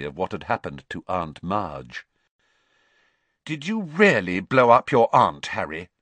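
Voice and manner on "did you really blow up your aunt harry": very serious. A narrator's reading. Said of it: "said Hermione in a very serious voice".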